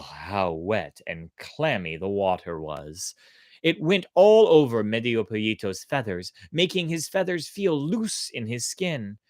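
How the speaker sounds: ordinary delivery, nothing marked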